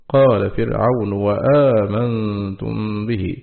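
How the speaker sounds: وآمنتم به recited slowly, the hamza turned into a wa with fatha; slow Quranic recitation of وآمنتم; slow recitation demo. Shown as a demonstration. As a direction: chant قال فرعون وآمنتم به slowly, wa-amantum joined. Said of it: "يبدل في حال الوصل من همزة الاستفهام واوا مفتوحة".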